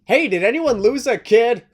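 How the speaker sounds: mock Seth taunt. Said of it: "what Seth Rollins should have totally said".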